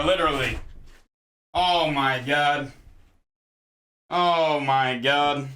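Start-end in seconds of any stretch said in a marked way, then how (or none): 1.5-5.5 stunned. Real, not acted. Water spilled, stunned.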